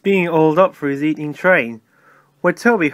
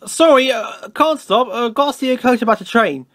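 higher, flustered excuse; hurried; 6 semitones higher than usual. A narrator's reading. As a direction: blurt it out, eager to get away. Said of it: "he went on hurriedly".

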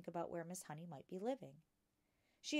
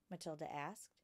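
ordinary delivery, nothing marked

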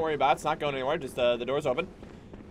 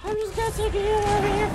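much higher put-on voice; sarcastic squeak; 8 dB louder than usual. Real, not acted. Gamer mocking himself while taking damage, squeaky.

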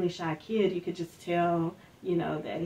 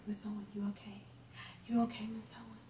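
put-on hushed worried whisper of kids; 3 semitones higher, 11 dB quieter, much faster than usual. Students checking on their stunned teacher, hushed worried whisper.

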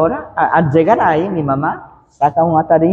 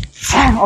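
high, sharp hissed "sa" of a blow; sudden swish-smack noise; imitated whack; 9 semitones higher than usual. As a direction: voice the metal ruler striking. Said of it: "suena así".

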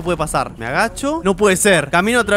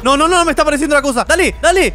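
high, fast frightened urging; rushing in real alarm; panicked hurry; hurried panic; 9 semitones higher, 4 dB louder than usual. Real, not acted. Thing appearing while rushing.

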